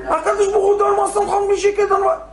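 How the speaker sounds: high, fast begging; pleading. Retold or imitated. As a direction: rush the plea up to God. Acted plea for the money.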